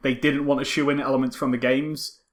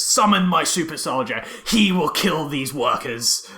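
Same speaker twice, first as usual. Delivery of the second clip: mock villain Bison. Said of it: "very wonderfully just goes".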